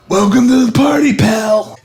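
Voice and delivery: high shouted catchphrase; mock shout. To the bad guy's body, a high shouted catchphrase. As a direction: yell it out fast and high. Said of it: "and he's like".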